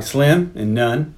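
slow, dry reply; dry drawl. Retold or imitated. McCoy answering how much of a chance they have, slow and dry. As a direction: drag out each word deadpan.

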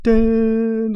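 high, loud startup chime; imitated boot-up chime. Mimicking the Apple boot up sound as Vader's helmet attaches, chiming. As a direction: sing out one ringing computer tone. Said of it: "like, the Apple boot up sound".

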